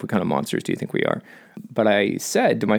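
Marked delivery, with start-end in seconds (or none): 0.0-1.2 low, fast, muttered mock indignation; mock offended aside. Joking to viewers about the burial, a fast low mock-offended aside. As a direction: toss off a fake-offended aside.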